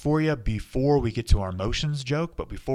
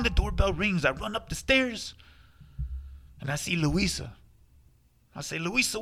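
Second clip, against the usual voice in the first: lightly put-on Spanish accent, joke telling; a hint of a Spanish accent telling a party joke; 4 semitones higher than usual. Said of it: "always used an accent, so that's the only way I know how to tell it".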